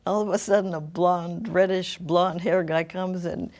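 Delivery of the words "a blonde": ordinary delivery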